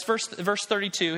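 ordinary talk

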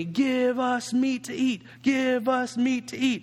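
slow, drawn-out whiny chant; mock wailing. Preacher imitating the hungry people complaining to Moses, mock wailing. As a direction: wail it slowly like nagging kids. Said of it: "They keep wailing to me".

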